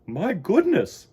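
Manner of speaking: drawn out like a delighted mother; amused mother bear. Acted. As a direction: Missus Bear amused at the thought. Missus Bear character voice.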